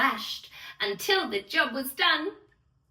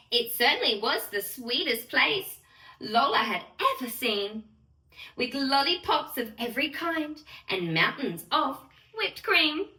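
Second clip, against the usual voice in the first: plain reading voice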